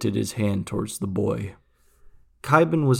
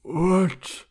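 lightly voiced feeble old man asking, higher; a dying old man barely able to ask; feeble, brittle voice; 8 semitones higher than usual. A narrator's reading. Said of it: "asked a feeble, brittle voice".

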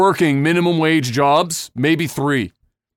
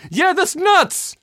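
high, slow, dripping sarcasm; sarcastic mock agreement; 12 semitones higher, slower than usual. Mocking the voter, in a high sarcastic drawl. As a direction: drag it out high as mock outrage.

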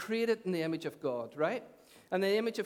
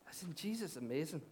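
badly imitated Scottish accent, softer; terrible Scottish accent; 3 semitones lower, 7 dB quieter than usual. Pastor quoting his friend Davy to the congregation, terrible Scottish accent. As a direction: have a poor go at a Scottish accent. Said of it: "With a Scottish accent, that was terrible".

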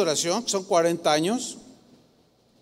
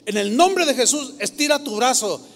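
louder, higher preacher's order; imitated healing command; 5 semitones higher, 4 dB louder than usual. Preacher woman orders grandfather to stretch, imitated forceful command.

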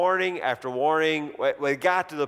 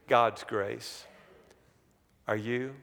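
ordinary talk, nothing marked

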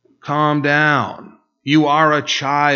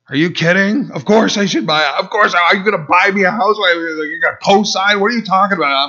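higher and louder, indignant retold rant; retold angry outburst; 7 semitones higher, 4 dB louder, faster than usual. Young husband reliving his rant at a rich churchgoer, indignant and heated. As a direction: fire back at the man telling you to buy a house. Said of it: "I was, like, steaming".

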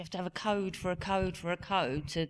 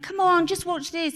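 higher, louder pleading; imitated coaxing mum; 11 semitones higher, 7 dB louder than usual. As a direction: urge reluctant kids to watch. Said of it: "I force them to watch it".

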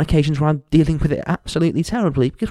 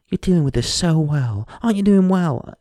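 sing-song, higher mock praise; mocking patronising voice; 5 semitones higher than usual. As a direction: parody the well-meaning people. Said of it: "they say things like".

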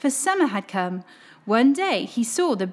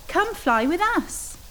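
higher, slow gentle invitation; inviting swans; 7 semitones higher, slower than usual. Acted. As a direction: beckon him to follow. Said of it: "they called back".

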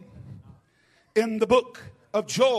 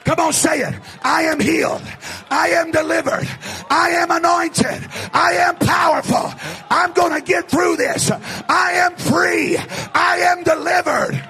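higher, shouted revival preaching; full-force preaching; 7 semitones higher, 7 dB louder than usual. Real, not acted. Preacher urges the congregation to say it, shouting fervently. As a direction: drive the congregation to declare it, at a shout.

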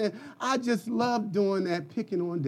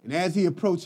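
ordinary talk

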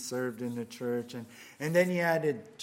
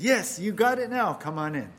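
much higher and louder, joyful punchline; triumphant Peter; 5 semitones higher, 9 dB louder than usual. Acted. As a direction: cry it out as Peter welcoming him in. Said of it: "And Peter says".

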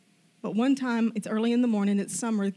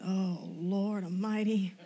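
slow, drawn-out appeal to heaven; slow comic oh-no; mock dread; 3 semitones lower, 5 dB quieter, much slower than usual. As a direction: drag it out softly, half laughing. Retelling dread at intimidating player's arrival.

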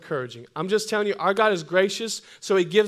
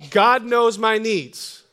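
slow and deliberate, each word set down; a firm confession spoken slowly; slow declaration; 3 semitones higher, 6 dB louder, much slower than usual. Shown as a demonstration. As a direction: lay the line down slowly for them to repeat.

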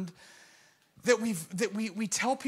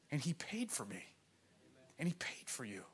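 hushed whisper; 3 semitones lower, 14 dB quieter than usual. Acted. Preacher whispering Christ's sacrifice to the congregation. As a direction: breathe it out tenderly.

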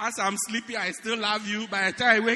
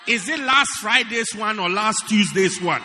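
wife's loud grilling questions; mock interrogation; 7 dB louder than usual. Preacher voicing the wife, grilling her husband loudly. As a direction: grill him, louder and louder.

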